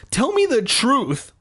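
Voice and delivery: high, pleading demand; insistent. A narrator's reading. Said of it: "I insisted".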